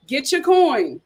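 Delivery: ordinary delivery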